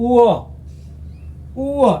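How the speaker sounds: উয় glided from u to a short o; Korean vowel wo. Shown as a demonstration. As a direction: say উয় twice evenly. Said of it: "উ যোগ অ".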